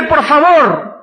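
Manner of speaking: loud, high, desperate shouted pleading; a man clinging on and shouting for a blessing; desperate plea. Acted. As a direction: beg with everything you have, crying out. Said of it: "se agarró de Cristo desesperadamente".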